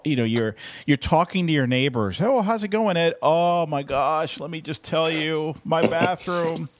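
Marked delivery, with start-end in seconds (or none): 2.2-3.1 cheery greeting. Retold or imitated. Greeting neighbor in acted scene. 3.2-6.7 slow, higher, dramatic groaning complaint; mock exasperation. Neighbor venting about bathroom.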